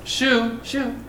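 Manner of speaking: mock-timid little brush-off; half-hearted shooing. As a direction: shoo them feebly, as a joke. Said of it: "Do you think he did that like".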